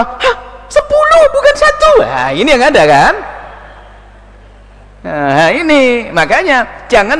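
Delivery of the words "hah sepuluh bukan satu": high, indignant squeal of disbelief; shocked protest. Retold or imitated. Donor protests the announced amount in shock. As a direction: cry out at the wrong sum.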